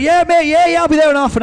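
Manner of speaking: high, fast, chirpy dealer on the phone; eager dealer voice. Retold or imitated. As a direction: rattle it off like a keen dealer.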